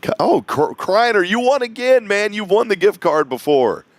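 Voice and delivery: light up at a familiar name; a host thrilled to hear a returning winner; surprised delight. Real, not acted. Recognizes repeat caller winner.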